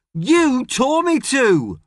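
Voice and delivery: high, loud and slow protest; shouting. Acted. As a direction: drag out a loud, defiant retort. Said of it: "shouts James".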